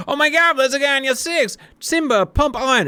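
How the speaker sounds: high-pitched hammed-up alarm call; retold dogfight warning to a wingman, mock frantic; mock panic. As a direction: play up the panic over the radio. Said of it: "and we were like".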